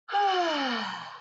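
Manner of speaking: long audible sigh out; big breath out. Shown as a demonstration. Teacher demos a big exhale for children. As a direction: breathe out slowly for the kids. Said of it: "take a big breath in".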